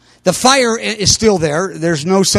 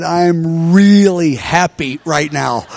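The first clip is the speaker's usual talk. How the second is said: slow, stretched-out contentment; lightly acted deep satisfaction, unhurried; retold happiness; 3 semitones lower, slower than usual. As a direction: linger on "really happy". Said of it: "meaning my spirit is alive".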